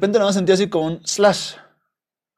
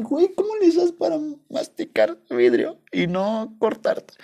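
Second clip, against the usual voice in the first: higher, slow, amazed; incredulous question to the dog; 11 semitones higher, slower than usual. Retold or imitated. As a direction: ask the dog in disbelief. Asking the dog in disbelief.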